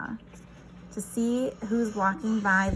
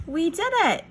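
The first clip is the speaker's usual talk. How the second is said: excited; 7 semitones higher, 4 dB louder than usual. Acted. Track finished together.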